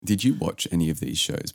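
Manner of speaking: ordinary delivery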